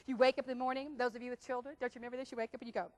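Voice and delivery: ordinary talk, nothing marked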